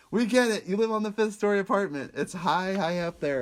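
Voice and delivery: loud, high-pitched exasperated retort; fed up with a joke that keeps repeating. Retold or imitated. Griping about the repeated stairs joke, high and exasperated.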